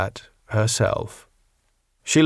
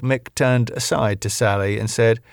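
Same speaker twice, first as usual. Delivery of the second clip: ordinary delivery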